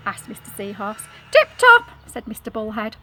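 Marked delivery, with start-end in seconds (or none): 1.3-1.9 gleeful fish. Acted. Bullhead answers with glee.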